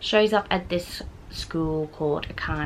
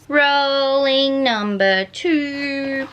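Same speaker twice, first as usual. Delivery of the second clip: slow, louder, higher drawn-out call; suspenseful announce; 6 semitones higher, 5 dB louder, much slower than usual. Real, not acted. Booktuber announcing the next roll dramatically. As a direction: stretch it like a game show host.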